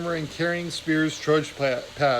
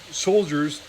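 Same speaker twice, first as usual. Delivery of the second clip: ordinary delivery, nothing marked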